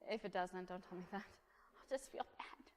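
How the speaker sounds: hushed, half-whispered confession; soft, throwaway aside; joking aside. Real, not acted. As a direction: drop to a near-whisper with a small laugh.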